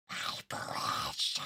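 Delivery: slow whispered mock crone on my precious; creepy voice. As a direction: breathe it out like a creepy old woman. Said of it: "so annoying voice".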